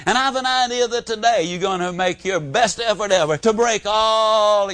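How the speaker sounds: high-pitched, hammed-up admiration for a cow; playful over-the-top sweet talk; gushing praise. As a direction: lay on lavish compliments, stretching the key words. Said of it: "You can look at her and say".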